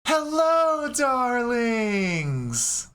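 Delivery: high, loud flamboyant greeting; campy drag queen. Acted. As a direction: sing it out like a campy diva. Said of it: "you see a hand just go".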